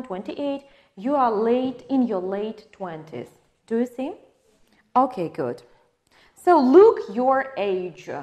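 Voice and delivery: normal talking voice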